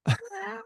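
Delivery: soft high giggle; amused laugh under the breath; kind gentle laugh. Real, not acted. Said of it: "I'm not laughing at you".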